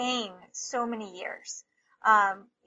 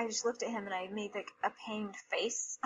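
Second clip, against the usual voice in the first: hushed recollection; 9 dB quieter than usual. Real, not acted. Recalling painful moment, voice drops away.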